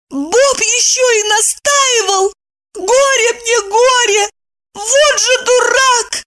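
high, drawn-out wailing verdict; upset lament. A narrator's reading. Evdokia grieves for her brother, lamenting high and slow. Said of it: "огорчилась за брата".

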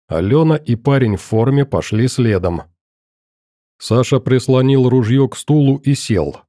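plain reading voice

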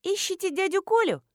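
put-on high-pitched girlish voice; teenage girl. A narrator's reading. Girl at the fence questions a stranger, teenage voice. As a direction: pipe up curiously like a schoolgirl. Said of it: "девочка лет тринадцати-четырнадцати".

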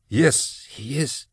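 high, soft, halting reply; stammered. A narrator's reading. Kara answers her old master about Gideon, stammering. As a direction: falter softly between the words. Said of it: "she stammered".

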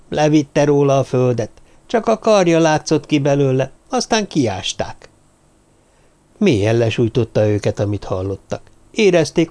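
ordinary delivery, nothing marked

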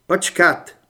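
loud, firm order; commanding order. Acted. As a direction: stop them with a sharp command. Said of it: "nařídil Torres". (rázný rozkaz)

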